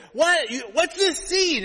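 high, loud, outraged imitation of mom; mock scolding. Mom spotting a C on the report card, mock outrage. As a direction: act out mom's drawn-out scolding. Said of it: "she kinda reamed me out".

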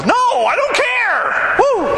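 joyful Pentecostal-style shout; excited whoop. Acted. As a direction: throw your hands up and whoop. Preacher whooping about being excited.

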